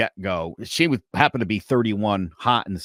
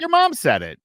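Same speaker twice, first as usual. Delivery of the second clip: mock ex-wife; 3 dB louder than usual. Mocking ex-wife, high pitch.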